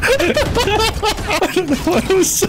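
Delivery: high, slow, wheezing through laughter; laughing. Real, not acted. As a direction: barely get it out laughing. Laughing fit.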